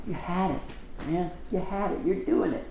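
hushed, high-pitched reassurance. Retold or imitated. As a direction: console a child who almost managed it. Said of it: "which is a little softer".

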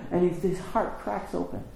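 ordinary talk, nothing marked

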